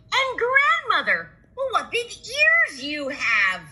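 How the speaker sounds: put-on high, slow wondering child; little girl voice. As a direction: gasp it out like a child.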